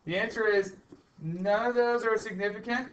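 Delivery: usual speaking voice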